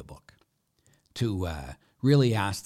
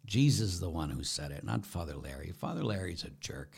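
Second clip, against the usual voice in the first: ordinary talk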